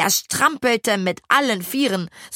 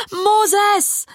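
high, loud shocked cry; a child's alarmed shriek; horrified cry; 13 semitones higher, 4 dB louder than usual. A narrator's reading. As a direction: cry out his name in horror. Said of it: "schrie Lille entsetzt".

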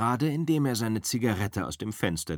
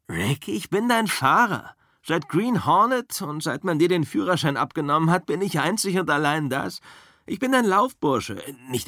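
higher, louder easygoing stuntman; Cliff's voice; 6 semitones higher, 4 dB louder than usual. Acted. Cliff tells Rick he's only his driver, higher and louder. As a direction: lay it out plainly to your boss.

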